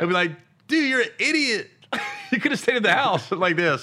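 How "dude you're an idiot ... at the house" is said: high-pitched, amused imitation of a friend; retold high-voiced telling-off; mock scolding. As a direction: scold like an exasperated pal, pitched up.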